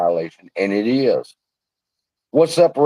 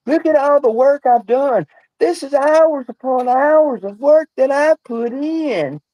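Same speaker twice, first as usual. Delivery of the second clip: much higher, slow, whiny mock voice; mocking whiny braggart; 13 semitones higher than usual. Mocking a YouTuber who brags about his work, high whiny imitation. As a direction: mimic a rival boasting about his hours of work. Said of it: "when people talking bullshit to y'all".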